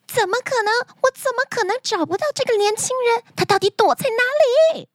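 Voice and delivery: high-pitched, rushed indignant outburst; angry princess. Acted. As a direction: rage in disbelief, pitched right up high. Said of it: "公主非常生气".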